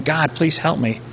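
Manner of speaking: understated tearful prayer; imitated crying plea. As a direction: plead quietly, as if crying to God. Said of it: "He's crying".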